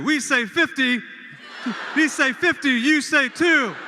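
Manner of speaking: shouted chant, laughing partway; cheer call. Shown as a demonstration. Host to the crowd, shouted chant with a laugh. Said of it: "now we're gonna say fifty".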